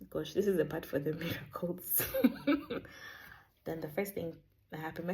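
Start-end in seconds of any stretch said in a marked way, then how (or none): 2.1-3.2 a bright burst of laughter; high laugh. Real, not acted. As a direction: laugh it off, amused. Laughing about the miracles.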